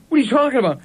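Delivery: mildly indignant, high-pitched question; incredulous retort. Retold or imitated. Retelling a baffled reply to the lady at Target, incredulous. Acting out his baffled protest.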